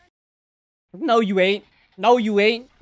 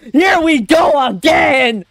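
slow, high, excited holler; real hyped-up shouting; 5 semitones higher, 3 dB louder, slower than usual. Real, not acted. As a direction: stretch each word into a yell. Excited as fight restarts, repeated.

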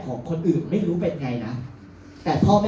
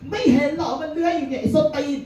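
much higher cursing; mother's curse; 11 semitones higher than usual. Retold or imitated. Mother swears at a weaving driver, much higher cursing. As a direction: snap the insult up high.

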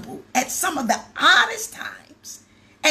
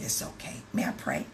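soft whisper; 9 dB quieter than usual. Retold or imitated. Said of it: "I said".

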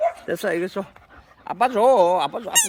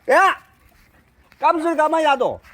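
loud, higher scolding shout; sharp shout; 9 semitones higher, 5 dB louder than usual. Real, not acted. Owner shouting at his dog to leave the other alone. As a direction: snap at the dog to stop.

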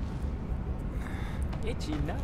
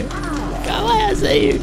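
high panicked squeal; 3 dB quieter than usual. Real, not acted. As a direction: squeal it in terror. Panicked at approaching killer.

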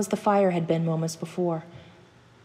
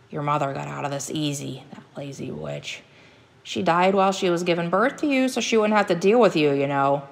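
put-on cruel old hunter. Hunter father blaming his boy for the mother's death, bitter.

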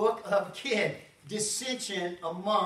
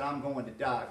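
grave quiet; 8 semitones lower, 6 dB quieter than usual. Retold or imitated. Jesus quoted, low and quiet.

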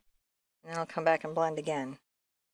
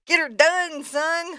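playful catchphrase; 8 semitones higher, 5 dB louder, slower than usual. Pretend.

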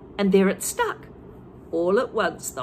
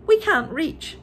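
small monkey; 7 semitones higher, 4 dB louder, slower than usual. A narrator's reading. Monkeys answering Mugglewump.

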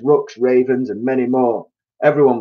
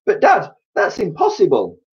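put-on high-pitched childlike protest; small bird's cry; 10 semitones higher, 3 dB louder than usual. A narrator's reading. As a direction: squeak an objection to dad. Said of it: "cried one of the small birds".

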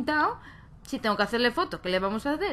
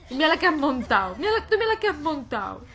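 laughing scold; 4 semitones higher, 5 dB louder than usual. Real, not acted.

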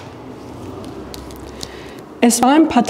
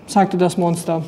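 plain reading voice

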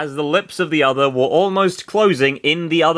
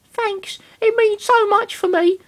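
put-on high girl voice. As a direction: say it high with a smile. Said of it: "Florina whispered, smiling".

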